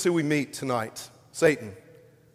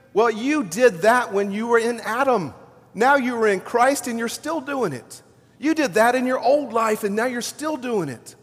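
higher-pitched, taunting accuser; Satan's mocking accusation; 6 semitones higher than usual. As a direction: jeer at them for being caught out. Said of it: "Satan points at you and laughs at you".

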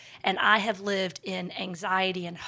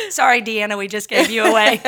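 loud apology through laughter; laughing apology; 11 dB louder, faster than usual. Real, not acted. Host apologising to Deanna for giving her away, laughing. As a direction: say sorry while cracking up.

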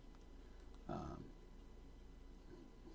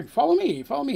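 eager beckoning; 9 semitones higher than usual. Acted. Guard beckoning them in.

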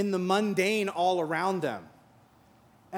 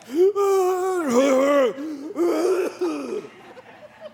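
wheezing gasp; 9 semitones higher, 6 dB louder than usual. Retold or imitated. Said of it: "groggily wheezing through the wall".

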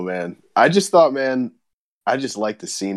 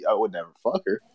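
ordinary talk

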